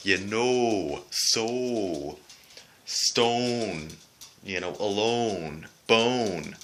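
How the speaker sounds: "so", "Stone", "bone" with round pure o; Canadian long o. Shown as a demonstration. Vlogger demonstrating the Canadian long O. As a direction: round every o Canadian-style. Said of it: "the Canadian way of pronouncing the letter O".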